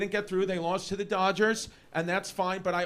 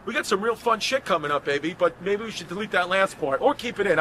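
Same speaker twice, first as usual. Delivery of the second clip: ordinary talk, nothing marked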